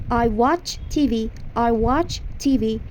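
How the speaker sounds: slow teacher demo of the sentence; slow model sentence. Shown as a demonstration. Teacher modelling English for Persian students, slow. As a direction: say it slowly for repetition. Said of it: "مثلاً".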